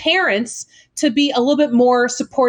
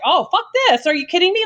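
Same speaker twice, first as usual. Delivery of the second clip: higher, exasperated mock retort; fed-up parent; 8 semitones higher, faster than usual. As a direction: scoff like a parent with no patience. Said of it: "oh, they're like".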